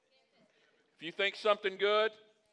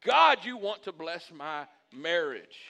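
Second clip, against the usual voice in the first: slow, loud, emphatic declaration; with gusto; 8 dB louder, slower than usual. Shown as a demonstration. Pastor modelling a prayer for his marriage, with gusto. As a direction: belt out "God", then stretch each word with conviction. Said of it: "you say it with gusto".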